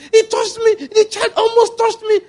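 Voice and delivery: mock panic in a high squeaky voice; scared monster. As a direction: squeal like a big monster spooked by a kid. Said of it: "there was one part of the movie".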